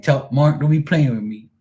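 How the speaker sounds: imitating her irritation. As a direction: mimic a friend getting fed up. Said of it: "she be getting irritated".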